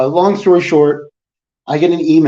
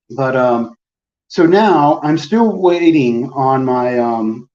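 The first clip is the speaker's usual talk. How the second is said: normal talking voice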